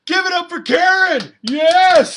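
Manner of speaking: loud high crowd-rousing shout; calling for applause for Karen; hype shout. Real, not acted. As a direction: pump up the room.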